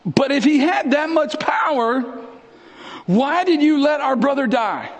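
high-pitched accusing protest; acted indignant reproach. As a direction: challenge him, voice pitched right up. Voices the sisters' reproach to Jesus.

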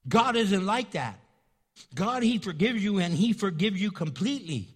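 preaching. Acted. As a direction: proclaim it with full force. Preacher proclaims God's forgiveness.

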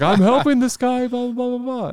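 put-on high excuse-making child voice; kid voice. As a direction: squeak it like a twelve-year-old. Kid explaining to his dad.

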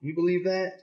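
plain reading voice

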